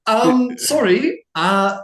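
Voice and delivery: high, loud flustered stammer; flustered Inara. Acted. Noble lady caught off guard by the crying boy, high flustered stammer. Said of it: "She literally is like".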